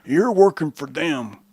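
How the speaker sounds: slow, mocking accuser; accusing critic. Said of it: "I'm being told constantly".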